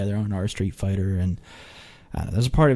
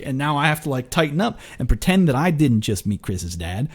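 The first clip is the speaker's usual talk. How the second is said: normal talking voice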